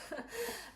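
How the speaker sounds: a quick amused laugh, high; short laugh. Real, not acted. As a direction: laugh at yourself for the retakes. Laughs at re-recording again.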